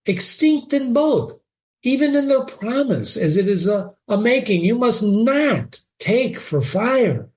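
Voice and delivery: put-on high, slow warning old father; stern father. Polonius forbidding Ophelia, stern high father. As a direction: lay down the law.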